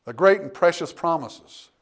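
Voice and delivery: ordinary talk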